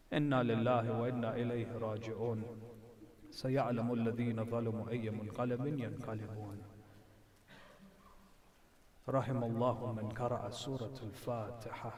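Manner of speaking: quick recited prayer formula to close the sermon; closing prayer recitation. Acted. Preacher ends the story for the mourners, in recitation. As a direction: rattle through the closing verses in one breath.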